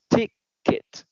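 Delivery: "ticket" slowly in two parts, final "t" sounded; clear ending sound. Shown as a demonstration. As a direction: finish "ticket" on an audible "t". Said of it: "just remember the ending sound".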